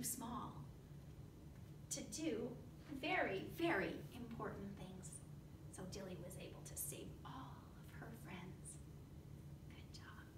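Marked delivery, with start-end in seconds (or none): 5.7-10.2 fast hushed whisper. Real, not acted. Teacher whispering praise to the class. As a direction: whisper the ending quickly. Wrapping up story.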